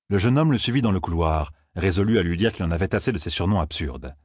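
ordinary delivery

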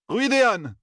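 high-pitched cry of outrage; explosive outburst. A narrator's reading. Young man exploding at his interlocutors, explosive outburst. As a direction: blurt it out, exploding. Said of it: "explosa-t-il".

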